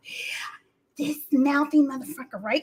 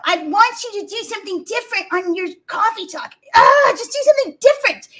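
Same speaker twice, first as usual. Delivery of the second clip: imitating Nathan; 6 semitones higher than usual. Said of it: "this guy was telling me".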